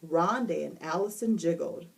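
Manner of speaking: usual speaking voice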